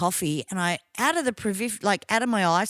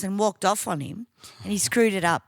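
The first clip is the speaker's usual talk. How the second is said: ordinary delivery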